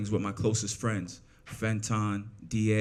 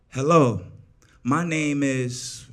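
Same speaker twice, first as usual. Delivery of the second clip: loud, slow, over-formal reading off paper; mock a first-timer reciting a script; stilted script reading; 2 semitones higher, 6 dB louder, much slower than usual. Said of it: "I read from a script".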